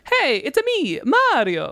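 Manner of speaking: high, bouncy Italian plumber; Mario voice. Retold or imitated. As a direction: pop the catchphrase up high. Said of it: "that is where the ... was introduced".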